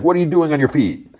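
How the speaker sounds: high, put-on challenge; demanding robber. Robber Yellow finds Matt standing, high and demanding.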